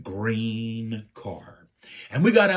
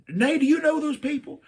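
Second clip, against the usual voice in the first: incredulous; 4 dB louder than usual. Retold or imitated. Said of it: "I'm like".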